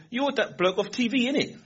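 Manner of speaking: put-on high-pitched London youth slang accent; young street slang accent. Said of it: "They all talk like that".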